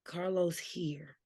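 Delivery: hushed dramatic whisper, slowed right down. Acted. Friend whispers the big arrival to her co-host, hushed. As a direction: breathe the name like a secret reveal.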